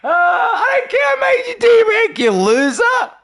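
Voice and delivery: very high, squeaky mock shout; mocking squeal. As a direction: squeal it in a silly taunting voice. Mocking the players who complained.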